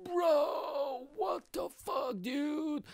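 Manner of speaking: gasp, then high, drawn-out wail; mock anguished disbelief. Reacting to news he was Bulgarian, wailing disbelief. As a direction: wail it in hammed-up dismay. Acted shock on hearing he's Bulgarian.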